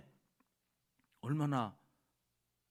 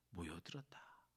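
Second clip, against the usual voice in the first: hushed whisper of 모여들었다; 7 semitones lower, 13 dB quieter than usual. Shown as a demonstration. Preacher hushing as the crowd gathers, whisper.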